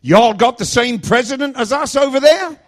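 high, loud Alabama questioning; mock Alabama accent. Driver asking about London, loud high Alabama accent. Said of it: "This accent is a Vaughnabama accent".

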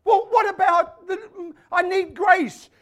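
high, loud mock pleading. Christians coming to God, loud mock pleading. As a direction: whine it at a shout, very high. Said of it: "Christians coming to God and say".

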